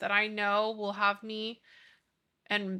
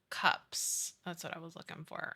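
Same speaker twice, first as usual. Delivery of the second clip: usual speaking voice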